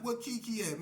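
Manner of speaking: put-on high, hollered greeting to an old friend; hollering greeting. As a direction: call it out high across the room. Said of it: "hollering fifty Crip this".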